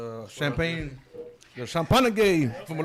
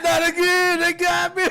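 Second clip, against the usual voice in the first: mock whine; 9 dB louder, slower than usual. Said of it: "He said".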